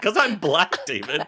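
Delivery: high, imitated yell through laughter; hammed-up high-pitched outburst; retold scream. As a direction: mimic a friend screaming at you. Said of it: "I will never forget you screaming at me".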